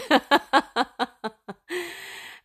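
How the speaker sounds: high, loud burst of laughter; real laugh. Real, not acted. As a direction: laugh out loud in a long rising peal. Laughs after imitating her mother.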